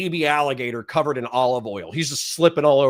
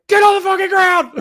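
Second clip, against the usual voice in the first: high, loud police shout; yelled command; 9 dB louder than usual. Retold or imitated. Cop ordering a skittering suspect down, full yell. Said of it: "So I yell at him".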